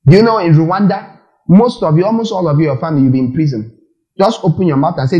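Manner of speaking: ordinary talk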